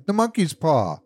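high-pitched frantic exclamation; wild cry. A narrator's reading. Wife waking her husband in the night, wild cry. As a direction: burst out wildly with the discovery. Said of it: "she cried wildly".

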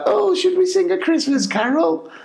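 high, sugary grotto voice; mock a twee Mrs Claus; cheesy Mrs Claus. Dad imitating Mrs Claus inviting the kids to sing.

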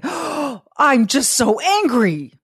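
high, slow gasping mock anger; mock outrage. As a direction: ham up the hurt reader. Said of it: "you could say".

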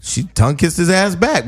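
ordinary delivery

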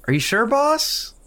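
high-pitched hireling voice; doubtful henchman. Acted. Henchman objects to releasing the worm, high and doubtful. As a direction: protest in a high, hesitant voice.

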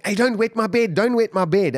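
high-pitched mock shout, half asleep; retold dream shouting. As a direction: call it out as if yelling in a dream. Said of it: "I was shouting in my dream".

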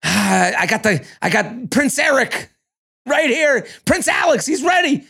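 high-pitched swooning rom-com heroine, climbing to a squeal; mock gushing. Podcaster playing Victoria praising Prince Alex, mock-excited gushing.

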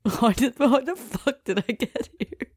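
high-pitched, giggling through the words; real laughter breaking into the question; laughing. Real, not acted. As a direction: crack up while asking it. Cracks up at own rambling.

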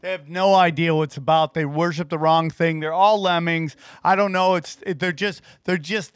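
ordinary talk, nothing marked

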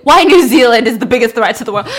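very loud, emphatic lecturing; hammed-up dramatic announcement; booming declaration. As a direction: proclaim it at the top of your voice. Acting dad's lecture, louder with slip.

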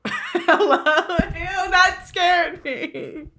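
high startled squeal through laughter; jump, then laugh it off. Real, not acted. Startled by something on screen.

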